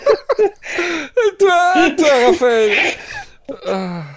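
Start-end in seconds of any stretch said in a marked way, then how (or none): 0.0-2.8 laughing so hard it squeaks; crying with laughter while talking. Real, not acted. Laughing hard after the joke.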